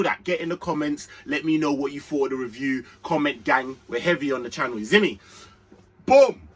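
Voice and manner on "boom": loud high burst on boom; excited shout. Real, not acted. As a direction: punch it out to kick off the review. Hyped transition shout.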